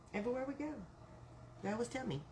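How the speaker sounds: ordinary talk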